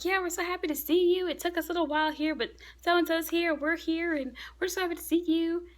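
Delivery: high, bubbly mock rambling; imitating excited chatter. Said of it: "Luffy's just going on and on".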